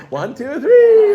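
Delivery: three shouted very high and loud; playful child count. Retold or imitated. Mother imitating counting with a toddler, gleeful shout. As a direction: build to a jubilant high three. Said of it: "with a child, what do you do?".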